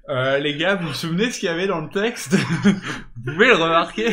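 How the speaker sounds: voix jouée, forte et rieuse, du gars du comité; imite le type du comité. Retold or imitated. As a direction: appel moqueur entre deux rires. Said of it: "il appelle". (imitation du type du comité qui appelle)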